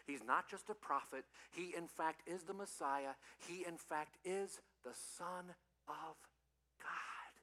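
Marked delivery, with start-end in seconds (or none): 4.2-7.3 slow, soft, falling to a whisper; pause, then breathe the last word; hushed, awed declaration; reverent climax. Real, not acted. Solemn climax of the point.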